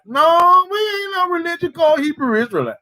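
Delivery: high, forceful preacher denial; emphatic rebuttal. Real, not acted. Preacher refuting the congregation's critics, high and forceful. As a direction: throw the No up high and hammer it.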